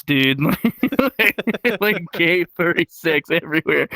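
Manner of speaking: ordinary talk, nothing marked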